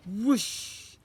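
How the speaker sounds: loud rising whoosh; whoosh sound. Acted. Wand being waved, loud whoosh. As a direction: swish it like a wand.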